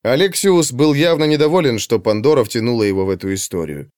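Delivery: plain reading voice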